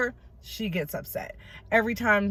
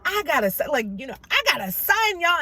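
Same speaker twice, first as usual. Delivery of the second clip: higher, lightly voiced proud new father; happy boasting; 6 semitones higher, faster than usual. Abraham tells everyone about his son, happy and excited. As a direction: announce it with mock delight, pitched up. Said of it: "And Abraham's happy".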